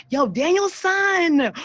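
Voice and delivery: high, drawn-out playful holler; playful call-out. Pretend. Host hailing someone by movie nickname, playfully calling out. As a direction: call out high and stretch the name.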